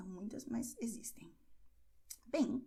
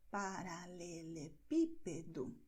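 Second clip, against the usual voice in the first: "Paralelepípedo" slow and lower, leaning on PI; stress on PI; 4 semitones lower, 5 dB quieter, slower than usual. Shown as a demonstration. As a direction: model "Paralelepípedo" slowly, softer, with PI stressed. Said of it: "é assim como você vai pronunciar". ("Paralelepípedo" lento e mais grave, com destaque no PI)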